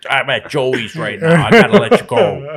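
mock a friend's thick Chicago accent. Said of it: "making fun of me for it".

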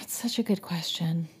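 hushed whisper. Real, not acted. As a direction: breathe it secretively. Hushed reply, mock mystery.

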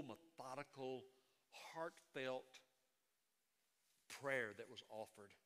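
ordinary delivery

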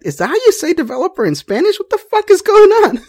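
high-pitched incredulous outburst breaking into laughter; baffled disbelief. Retold or imitated. New lead reacting to Spanish-speaking team, laughing bewilderment.

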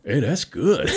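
loud, high New York voice; New York brogue. Retold or imitated. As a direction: imitate a New Yorker pleasantly surprised by a drink. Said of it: "great New York brogue".

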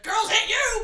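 loud, high laughing yell; shouted retort. Retold or imitated. Yuffie yelling back at him mid laugh. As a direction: bark the comeback through a laugh.

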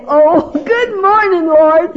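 slow, bright welcome to the day; cheerful morning greeting. Retold or imitated. Women's retreat speaker mimics a cheerful morning riser. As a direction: greet the Lord gladly at waking. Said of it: "some people get up and they say".

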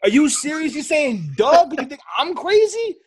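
high, loud mock disbelief; retold baffled outburst; incredulous retort. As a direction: act out escalating disbelief, pitch climbing to the end. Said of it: "I'm like".

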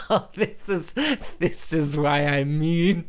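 dragged out slowly through laughter; laughing reply. Retold or imitated. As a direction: giggle the words out, slowly. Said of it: "I was like".

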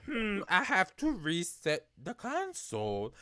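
high-pitched drunk impression, pondering; mock drunk reaching a big decision. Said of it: "I should change my voice".

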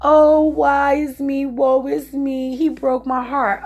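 woe-is-me mockery; mock self-pity. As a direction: ham up poor-me self-pity. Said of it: "I didn't look at myself as".

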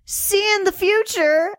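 high-pitched mock farewell, lilting upward; woman's farewell line. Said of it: "but she goes".

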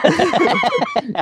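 very high squealing laugh; shrieking laugh. Real, not acted. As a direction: let out a high delighted shriek. Laughing after the bit.

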